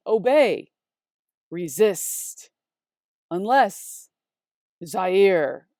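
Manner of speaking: "obey, resist, Unless Zaire" each stressed on the second syllable; spaced-out list, weight on the end of "obey, resist, Unless Zaire"; soft-heavy words. Shown as a demonstration. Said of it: "a list here of soft, heavy".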